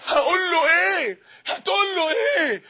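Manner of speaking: high, drawn-out wail of a question; desperate, stretched-out plea; anguished dread. Acted. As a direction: cry it out slowly, pitch climbing. Dreading meeting God, anguished question.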